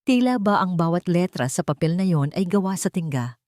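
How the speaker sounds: plain reading voice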